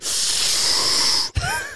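long hissing inhale; exaggerated sharp intake of breath; sucking in air. Clerk hearing he usually takes C four, sucking in air. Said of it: "and he just sucks in".

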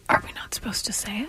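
quick, hushed whispered question; joining-in whisper. Pretend. Co-host asking if it's secret, whispering.

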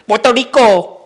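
high, loud, over-rolled Spanish pronunciation; mock an overdone Spanish accent; showy Spanish accent, punched out; exaggerated Spanish accent. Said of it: "as soon as they say a Spanish word".

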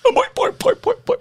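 put-on high springy boy boy chant; boing catchphrase. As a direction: bounce it like a cartoon spring.

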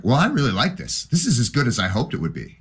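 high, eager gushing; a teen falling for the record; delighted discovery. Retold or imitated. His younger self delighted with song.

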